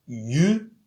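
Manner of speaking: usual speaking voice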